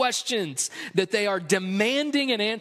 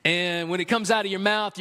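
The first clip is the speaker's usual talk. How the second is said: ordinary talk, nothing marked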